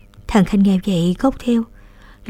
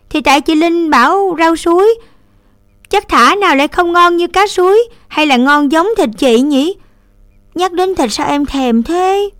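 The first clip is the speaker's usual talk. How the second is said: put-on higher, louder child, slowing at the end; pleading child; 9 semitones higher, 4 dB louder than usual. A narrator's reading. Little brother defending himself and craving meat, childish. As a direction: whine it like a hungry little kid. Little boy answers sister.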